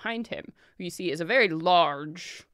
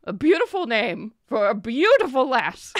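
higher, louder charmer; flattering merchant; 6 semitones higher, 7 dB louder than usual. Acted. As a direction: lay the flattery on thick.

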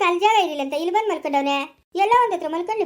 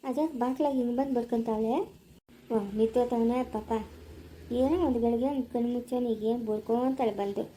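grumbling aside; 6 semitones lower, 7 dB quieter than usual. Acted. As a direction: mutter to yourself so she won't hear. Husband grumbles inside, aside. (ಒಳಗಿನಿಂದ ತಗ್ಗಿದ ದನಿಯಲ್ಲಿ ಗೊಣಗುವುದು)

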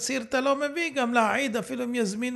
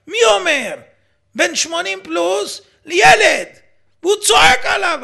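much louder and higher, openly outraged; indignant outburst; 6 semitones higher, 12 dB louder than usual. Real, not acted. Radio host to listeners, furious about a man yelling at an elder. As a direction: build it up in real indignation.

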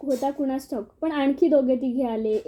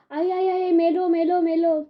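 high, drawn-out wail of panic; mock scream of terror, pitched up; terrified shriek; 4 semitones higher than usual. A narrator's reading. Frightened wrestler cries out on seeing the monkey face, shrieking. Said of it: "त्यांनी एकदम किंकाळीच फोडली".

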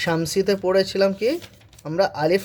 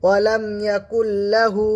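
Quran recitation; 4 semitones higher than usual. Shown as a demonstration.

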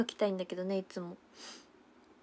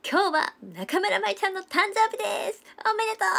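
higher, excited birthday call; loud announcement; 11 semitones higher than usual. Retold or imitated. Imitating a member loudly announcing a birthday, high and excited. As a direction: announce it to the whole room. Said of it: "すっごい大きい声で".

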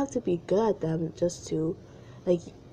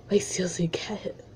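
whispering; 5 dB quieter than usual. Real, not acted. Whispered aside.

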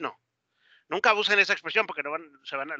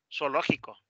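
ordinary talk, nothing marked

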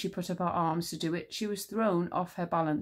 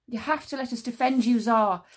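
Encanso; 5 semitones higher, 6 dB louder than usual. A narrator's reading. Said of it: "said Encanso".

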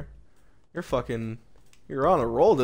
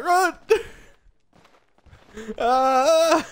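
laughing mock fright, telling them to run; mock panic; 10 semitones higher, much slower than usual. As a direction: play at fleeing in fake terror. Panicked pretend flight, laughing.

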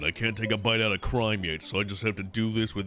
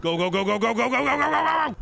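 higher, louder rising go chant; frantic cheering; 11 semitones higher, 4 dB louder, much faster than usual. Real, not acted. Gamer urging fish to the finish, building high yell. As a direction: drive it home, building.

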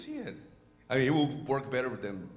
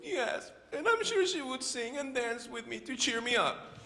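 high little-bird voice; hopeful chick asking to be cheered; Choco; 11 semitones higher than usual. Acted. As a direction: speak up high as a small, wishful bird. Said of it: "How about you be the voice of Choco?".

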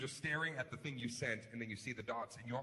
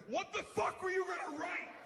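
high, shouting rant at the texter; mock furious rant; 13 semitones higher than usual. Texter yelling at the friend who stopped typing, high furious shout. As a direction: snap and yell into the phone. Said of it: "snap and call the person".

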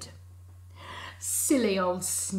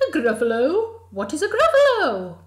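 owl shrieks much higher and louder; astonished owl; 8 semitones higher, 13 dB louder, faster than usual. Acted. Owl astonished by the Gruffalo, squawking high. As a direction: squawk the question.

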